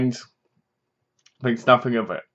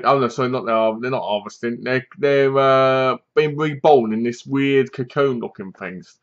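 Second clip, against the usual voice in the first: ordinary talk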